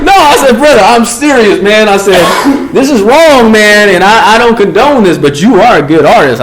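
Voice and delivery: urging an inmate, loud and earnest; loud insistence. Retold or imitated. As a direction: insist on it over his protests. Insisting loudly he is serious.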